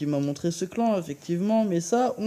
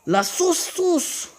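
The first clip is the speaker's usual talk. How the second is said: silly high voice; 10 semitones higher, slower than usual. Pretend. Silly hype on the attack.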